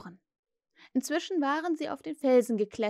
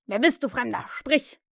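loud, commanding challenge; demanding; 5 dB louder than usual. A narrator's reading. Hook challenges the unseen stranger, forceful demand. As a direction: order the stranger to speak. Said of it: "forderte Hook".

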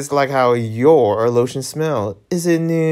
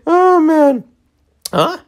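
laughing cry; 4 dB louder, slower than usual. Real, not acted. Reacts to failing the game.

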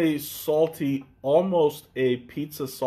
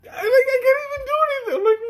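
laughing fit; 4 dB louder, much faster than usual. Real, not acted. Laughing fit over friend.

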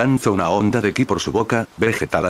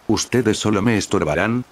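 plain reading voice

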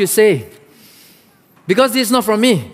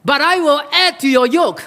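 harsh, rising higher toward the end; harsh king; 7 semitones higher than usual. A narrator's reading. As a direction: read the king's threat harshly, climbing in pitch. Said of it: "answered the people harshly".

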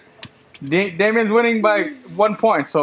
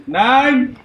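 referee count; 7 semitones higher, slower than usual. Acted.

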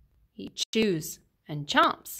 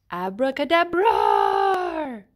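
slow, grand magician's incantation; magic spell; 2 semitones higher, 4 dB louder, slower than usual. Acted. Allosaur doing magic tricks, slow grand spell. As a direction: cast it like a showy stage magician.

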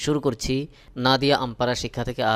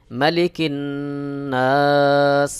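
chanted, louder নাস; recitation; 4 dB louder, much slower than usual. Acted. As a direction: intone the verse. Recites verse.

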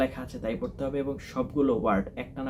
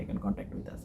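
whispered repeat; 9 dB quieter, much slower than usual. Shown as a demonstration. Tutor urging viewers to get in touch, whispered. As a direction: drop to a near whisper on the repeat. Repeated call to contact channel.

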